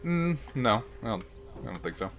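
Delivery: ordinary delivery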